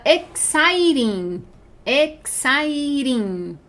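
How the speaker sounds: "exciting" stretched very slowly; long, slow "exciting" to show its length; drawn-out syllables. Shown as a demonstration. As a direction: draw out every syllable of "exciting". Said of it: "mais de duas sílabas".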